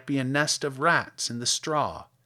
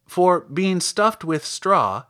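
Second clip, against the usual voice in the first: usual speaking voice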